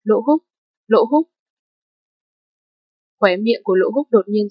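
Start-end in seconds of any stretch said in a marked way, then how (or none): 0.0-1.3 man down shout. A narrator's reading. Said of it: "đành ghé vào lỗ tai anh nói lớn".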